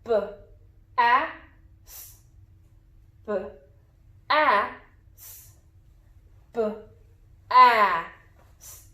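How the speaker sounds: B, A, S as isolated sounds: buh, short a, sss; sounding out. Shown as a demonstration. Teacher says first syllable sounds, B, A, S each apart. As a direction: say B, A, S as sounds with pauses, not letter names. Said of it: "the sounds in our first syllable".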